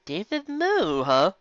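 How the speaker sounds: high, slow disbelieving echo; high drawn-out doubtful repeat; incredulous. Acted. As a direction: drag it out in disbelief.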